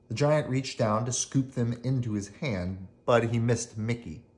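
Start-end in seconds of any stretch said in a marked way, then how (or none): none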